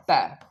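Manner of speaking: पै as short "pæ"; American pronunciation. Shown as a demonstration. Teacher repeating the US syllable, "pæ" पै. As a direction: say पै with cat-like a. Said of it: "क्या बोल देंगे".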